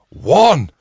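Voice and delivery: sudden high loud yell of one; shouted call. Retold or imitated. Rugby player calling the throw, a loud yell. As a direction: bark the line-out call out loud.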